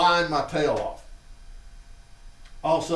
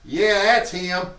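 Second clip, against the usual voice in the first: put-on old lady identifying; 6 semitones higher, 7 dB louder, much slower than usual. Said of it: "I hear Miss Cooley go".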